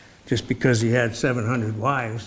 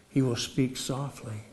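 lowered, soft voice; speaking softly; 8 dB quieter than usual. Shown as a demonstration. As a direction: drop to a gentle, quiet delivery. Preacher illustrates speaking softly.

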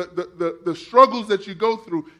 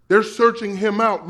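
ordinary talk, nothing marked

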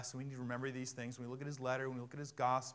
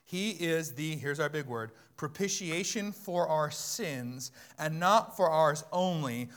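loud, slow, weighty verse reading; slow, forceful pulpit proclamation; emphatic scripture reading; 4 semitones higher, 13 dB louder, slower than usual. Real, not acted. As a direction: proclaim the verse to the whole church. Reads verse at full force.

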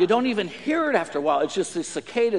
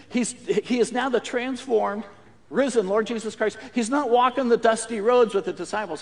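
ordinary delivery

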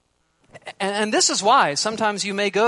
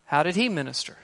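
ordinary talk, nothing marked

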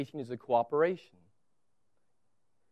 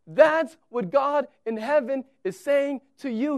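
usual speaking voice

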